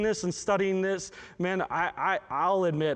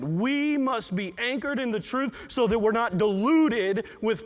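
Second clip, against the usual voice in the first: rising, louder preaching push; 5 semitones higher, 3 dB louder than usual. Acted. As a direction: drive the warning home to the congregation. Pastor presses the warning home.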